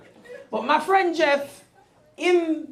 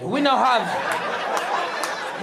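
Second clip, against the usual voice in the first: normal talking voice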